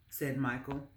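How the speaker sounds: ordinary delivery, nothing marked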